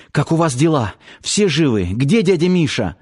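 high, rushed worried questions; breathless questions. A narrator's reading. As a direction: fire questions while running. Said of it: "На бегу посыпала вопросами".

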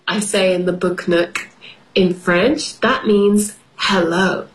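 ordinary delivery

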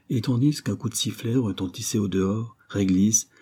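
usual speaking voice

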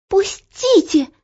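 high, drawn-out cry to be released; struggling girl's cry. A narrator's reading. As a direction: cry out as if tugging free. Girl grabbed, struggles free.

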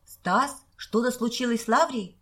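mildly alarmed, voice lifting a little; a touch of sudden worry in the question. A narrator's reading. Петька fears something happened to Лаврия, mildly alarmed. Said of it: "перепугался Петька".

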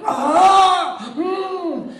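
high loud strained groan; hammed-up pain groan. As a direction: push out a horrid groan. Grotesque fake pain groans.

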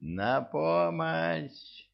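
high, slow cry for help; shouting for help. A narrator's reading. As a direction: wail it out, drawn long. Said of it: "завопил мэр".

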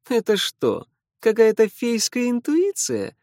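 high, mocking sneer; smirking. A narrator's reading. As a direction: tease the fairy's hunch. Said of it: "усмехнулся Лео". (с усмешкой)

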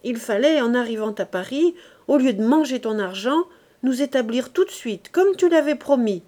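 growing heated. A narrator's reading. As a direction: push back hotly at last. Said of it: "dit-elle en s'animant".

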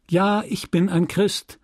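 put-on high child's recital; bright children's voices. A narrator's reading. Children answering the priest's catechism, high recited reply. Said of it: "die hellen Stimmen der Kinder".

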